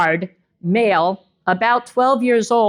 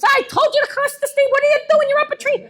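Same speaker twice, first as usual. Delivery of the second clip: high, hammed-up Irish mama bear scolding her cubs; Irish brogue. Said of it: "put it on an Irish brogue".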